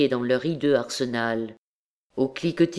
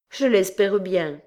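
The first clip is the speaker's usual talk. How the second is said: bitter relief; 5 semitones higher than usual. A narrator's reading. Girl welcomes death.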